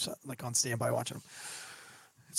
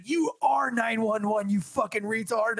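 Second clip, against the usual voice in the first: retold angry yell; 6 semitones higher than usual. Retold woman yelling insult.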